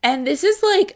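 ordinary delivery

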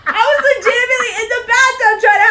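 high, loud laughing defense; laughing protest. Real, not acted. Defending herself to her friend, high laughing protest. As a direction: shout her innocence, laughing.

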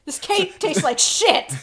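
high, slow, loud chef verdict; mock Gordon Ramsay. Tasting the cake, playing the TV chef, slow and high. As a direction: drag out the disgusted verdict like a TV chef. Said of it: "Gordon Ramsay of fart cakes".

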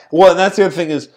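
normal talking voice

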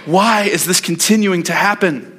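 high, strained plea to God, pitch far up and slowing on the questions; weary pleading David. Retold or imitated. Preacher voicing exhausted David begging God why. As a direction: cry out to God like a man worn out from running. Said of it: "David is reaching capacity of running".

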